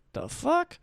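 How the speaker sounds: high-pitched retold disbelief; baffled reaction. Bartender reacting to news of Bud Platinum, baffled. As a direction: pitch it up, incredulous.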